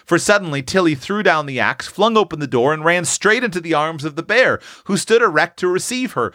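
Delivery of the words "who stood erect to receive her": plain reading voice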